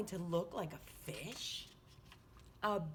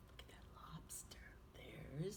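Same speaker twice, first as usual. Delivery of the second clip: put-on hushed whisper to the kids; 5 semitones lower, 13 dB quieter than usual. Teacher pointing out the jellyfish to children, hushed whisper. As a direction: whisper it like sharing a secret.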